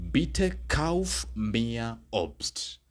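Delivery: very slow, drawn-out German request; slow model request. Shown as a demonstration. Teacher modelling a request for students, very slow. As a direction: stretch every word of the request. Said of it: "I could form the following request".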